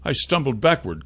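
ordinary delivery

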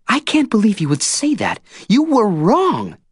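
Tris furious at his accusation; Tris, outraged. Acted.

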